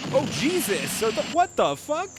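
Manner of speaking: high, loud disbelieving yelp; shocked outburst. Real, not acted. Reviewer shocked by flamethrowers, loud disbelieving yelp. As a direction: blurt it out, caught off guard. Sudden flamethrowers appear on screen.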